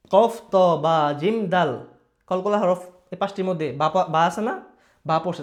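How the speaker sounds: ordinary talk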